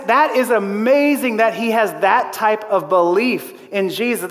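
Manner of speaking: ordinary delivery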